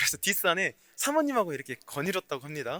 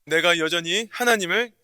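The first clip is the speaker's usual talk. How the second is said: ordinary talk, nothing marked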